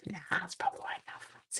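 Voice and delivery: quick soft whisper, fast; hushed fast murmur; whispered aside. Real, not acted. Muttered aside to self.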